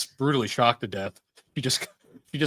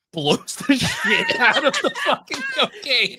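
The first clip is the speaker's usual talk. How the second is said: laughing hard; 5 dB louder, slower than usual. Real, not acted.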